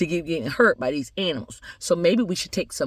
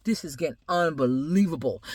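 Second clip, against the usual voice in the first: usual speaking voice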